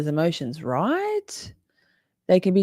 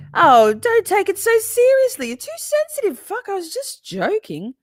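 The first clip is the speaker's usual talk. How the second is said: very high, exaggerated dismissive voice; mocking imitation; 5 dB louder than usual. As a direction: squeal the excuse to ridicule whoever says it. Mimics abusers excusing cruel humor.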